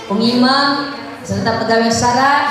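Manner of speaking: normal talking voice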